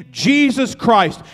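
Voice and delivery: slow, loud, drawn-out proclamation; booming climax of the sermon; preaching shout. Real, not acted. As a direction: thunder out the name.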